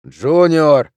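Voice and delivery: loud, sharp reprimand; commanding shout; barked name. A narrator's reading. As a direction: bark the name to cut him off. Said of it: "рявкнул Амброуз".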